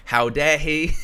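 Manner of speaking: mock outrage. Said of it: "started cussing him all out, going".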